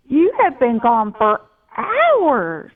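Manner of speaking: a touch of the husband's fury; angry husband. Retold or imitated. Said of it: "my husband was so angry with me".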